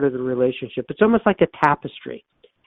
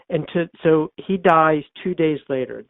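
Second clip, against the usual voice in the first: ordinary talk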